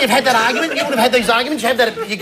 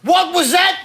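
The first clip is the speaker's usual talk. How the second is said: high raised-voice question; calling back; 7 semitones higher, slower than usual. Retold or imitated. Husband calling to partner in another room, high shout. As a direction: call out, not having caught it. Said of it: "And you'll go".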